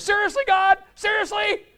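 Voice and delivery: high, loud incredulous cry; exasperated disbelief. Retold or imitated. Man in the water cries out to God, stunned. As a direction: whine it up at heaven, stunned. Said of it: "all he could say was".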